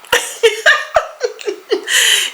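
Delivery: breathy high laugh; airy laugh. Real, not acted. Laughing at own joke.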